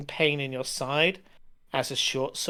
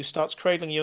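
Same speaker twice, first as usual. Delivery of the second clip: ordinary delivery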